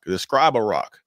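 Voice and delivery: ordinary talk, nothing marked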